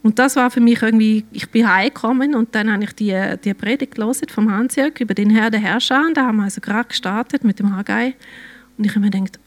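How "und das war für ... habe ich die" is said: ordinary delivery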